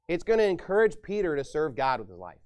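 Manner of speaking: ordinary delivery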